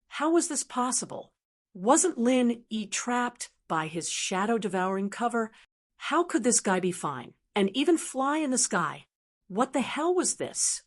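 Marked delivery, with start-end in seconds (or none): none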